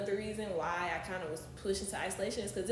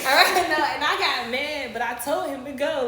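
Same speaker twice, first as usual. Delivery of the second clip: much louder and higher, laughing through the words; 7 semitones higher, 14 dB louder than usual. Real, not acted. Telling the host about the missed trip, laughing hard. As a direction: giggle it out in disbelief. Laughing at her own story.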